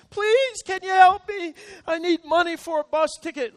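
high, loud begging voice; hammed-up plea for money; pleading stranger. Woman in a restaurant begging pastors for bus money, high.

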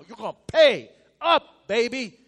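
loud, mocking creditor's demand; stern master. Preacher voicing the king to his debtor, loud and mocking. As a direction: lean in hard like a boss collecting.

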